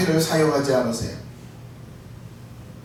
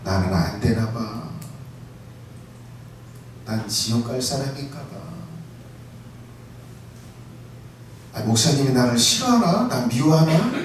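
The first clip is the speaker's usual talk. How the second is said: despairing believer; 7 semitones lower, 6 dB quieter than usual. Retold or imitated. Pastor voicing a discouraged believer, low and dejected. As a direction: sigh it out as a believer who feels rejected.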